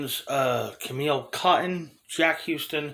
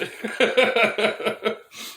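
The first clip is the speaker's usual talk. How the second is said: a hearty laugh rolling out; real laughter; 8 semitones higher than usual. Real, not acted. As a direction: laugh freely at the imagined comeback. Laughing after imagined retort.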